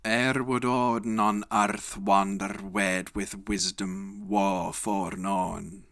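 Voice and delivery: put-on epic poem in Elizabethan English accent. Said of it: "in Elizabethan pronunciation".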